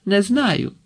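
high-pitched impatient retort; mild shout. A narrator's reading. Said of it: "крикнула Марта".

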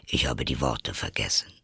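low, soft creature voice; Griffin voice. Acted. Griffin remarking on the choking turtle, low character voice. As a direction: drop well down for the Griffin.

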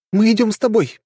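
put-on high, bright-eyed young princess; two girls speaking as one, eagerly; young woman voice. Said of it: "в один голос".

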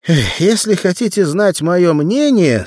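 high, loud, slow pronouncement; emphatic opinion. A narrator's reading. Arnold giving Sam his opinion, loud and emphatic. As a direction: declare it loudly, unhurried.

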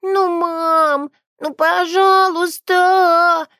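put-on high, slow child's whine, drawing out пожалуйста; a small girl begging her mother; whining little girl. A narrator's reading. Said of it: "хныкала Адель". (детское нытьё)

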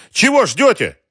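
high, loud impatient cry; shouted prodding. A narrator's reading. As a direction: yell at men who hesitate. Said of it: "снова крикнул он". (нетерпеливый громкий крик)

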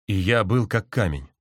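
ordinary delivery

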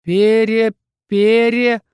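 stuck on a syllable, drawn out; begin, then freeze mid-word; stalled, repeated half-word; halting start. A narrator's reading. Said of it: "начал он и вдруг замер".

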